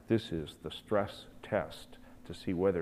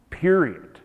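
a sudden loud, high "Period."; emphatic period; 7 semitones higher, 11 dB louder than usual. Real, not acted. Preacher closing the point to the congregation, emphatic. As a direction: slam the word down.

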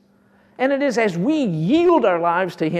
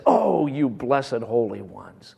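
lower, slow, tender address; tender blessing; 7 semitones lower, slower than usual. Acted. Preacher voicing God tenderly blessing the Corinthians, low and slow. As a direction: speak it gently like a loving father. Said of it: "and he says".

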